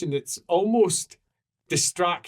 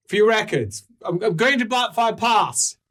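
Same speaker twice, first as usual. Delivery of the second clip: loud, high proclamation; emphatic declaration; 10 semitones higher, 5 dB louder than usual. Acted. Cleric stating destination for the records, loudly declaring. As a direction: announce it forcefully for all to hear.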